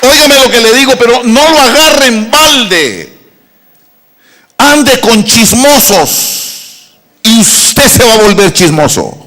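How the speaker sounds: loud, slow preacher warning; preaching at full force. Acted. Pastor warning the congregation about gossips: loud slow preaching. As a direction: thunder the proverb to the church.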